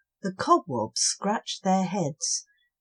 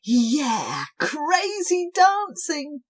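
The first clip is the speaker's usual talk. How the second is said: higher, slow and gleeful; excited cheer; 8 semitones higher, 4 dB louder, slower than usual. Acted. Cobwobblies cheering as the music starts. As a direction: whoop it up. Joining the dance.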